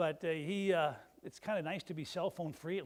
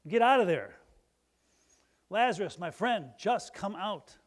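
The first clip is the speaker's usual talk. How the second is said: loud call into the tomb; 3 semitones higher, 8 dB louder than usual. Retold or imitated. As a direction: call him out of the grave, full voice. Jesus calls Lazarus from tomb.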